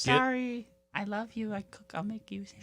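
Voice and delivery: hushed, meek apology; timid wife voice. Acted. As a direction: shrink back and apologize.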